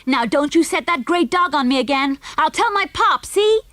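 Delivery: put-on high, loud childish threat; defiant yell. A narrator's reading. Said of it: "yelled Junior".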